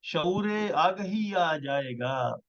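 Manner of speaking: measured, stately poetry recital; ghazal recitation. Acted. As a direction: recite each line with weight. Poet recites new ghazal.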